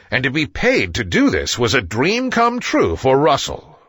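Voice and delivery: ordinary delivery, nothing marked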